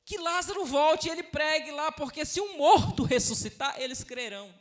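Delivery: high, loud acted protest; imitating a man pleading his case loudly; insistent objection. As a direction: argue back urgently, pitched well up. Rich man's objection acted out.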